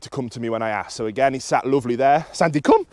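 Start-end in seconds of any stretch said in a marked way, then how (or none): 2.3-2.9 higher, louder call; recall cue. Real, not acted. Trainer calls the sitting dog to him, recall cue. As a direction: call the dog briskly with a rising end.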